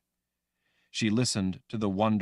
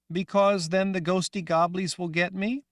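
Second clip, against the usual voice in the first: put-on high, loud young girl voice; childlike Rachel; 12 semitones higher, 5 dB louder than usual. Girl Rachel asking the woman, high and loud. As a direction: sound like a scared little girl.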